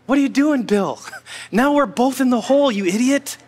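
high-pitched mock exasperation; exasperated. Jim scolds Bill for jumping into the hole, mock exasperated. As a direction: scold a friend in comic disbelief.

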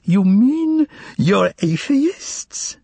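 high, very slow, mock-curious squeal; squealing with curiosity. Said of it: "practically squealed with curiosity".

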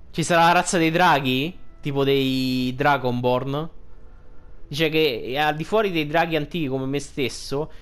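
normal talking voice

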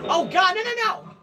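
high, loud string of refusals; panicked cry. Acted. Watching Patrick in danger, panicked cry. Panicked reaction to scary video.

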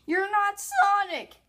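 high, loud playful contradiction; indignant mock shout. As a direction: call out the imposter, loudly. Acted argument over who is Sonic.

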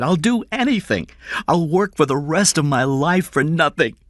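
high, loud, urgent begging; desperate pleading father. A narrator's reading. Father begs his employer to save his son, desperate pleading. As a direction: beg with rising desperation, pitched high.

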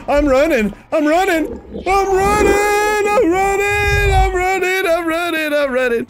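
high, stretched panicked cries; hammed-up fright, starting high and winding down; panicked yelling. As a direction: squeal it over and over while fleeing. Running from the chaser.

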